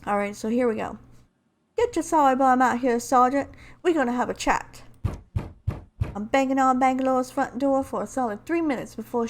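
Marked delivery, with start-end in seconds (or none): 1.8-7.8 raised, forceful amateur character voice; Lifeline impression. Lifeline demanding a chat at Bangalore's door, in character voice. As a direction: attempt a game heroine's accented voice, pushing it louder. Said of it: "trying to do my best Lifeline impression".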